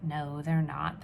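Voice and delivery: ordinary delivery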